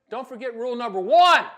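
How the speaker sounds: building to a shout on ONE; emphatic punchline. Real, not acted. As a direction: belt out the final word. Punchline of the rule.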